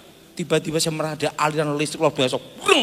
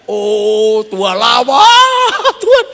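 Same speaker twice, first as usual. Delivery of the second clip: "Oh Tuhan, lawat Tuhan" drawn out slowly, higher and louder; confident prayer; 5 semitones higher, 7 dB louder, much slower than usual. Shown as a demonstration. Pastor demonstrates a bold, drawn-out, loud prayer. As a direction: pray "lawat Tuhan" slowly and boldly, rising high. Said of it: "Supaya ya, yakin".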